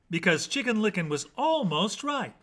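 ordinary delivery

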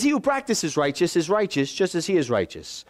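brisker, matter-of-fact scripture repeat; straight-through verse reading. Shown as a demonstration. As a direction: run through the verse without pauses.

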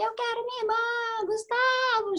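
high, pleading call from a hospital bed; patient crying out to her husband; desperate plea. Retold or imitated. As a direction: beg for your sister, voice up high. Calling for her sister in ICU.

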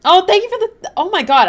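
high, loud burst of surprise; sudden delighted gasp of gratitude; excited thanks. Real, not acted. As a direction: squeal the thanks, caught off guard. Surprised by a donation.

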